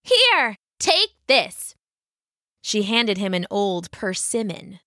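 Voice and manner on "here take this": rabbit voice. A narrator's reading. Rabbit speaks.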